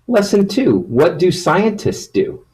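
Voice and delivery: high, excited announcing voice; excited announcement. Acted. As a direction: announce it brightly to the kids. Announcing new lesson.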